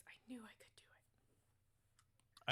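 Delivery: slow, whispered "I knew I could do it"; to himself. Acted. Goblin whispering to himself while holding the wand reverently. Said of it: "to himself he goes".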